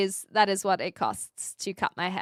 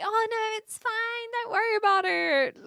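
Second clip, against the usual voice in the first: high, sing-song polite refusal; mock modesty; 11 semitones higher than usual. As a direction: put on a high, coy "oh, no, don't mind me". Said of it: "I'm not gonna be like".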